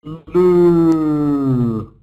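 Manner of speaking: "lu" drawn out long; long stressed syllable. Shown as a demonstration. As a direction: hold the stressed "lu" as one long vowel. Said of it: "second syllable is long because it's stressed".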